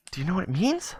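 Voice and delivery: high-pitched, hopeful question; eager kid. Acted. Kid asks homeless man about the word, eager. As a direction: ask high, rising at the end.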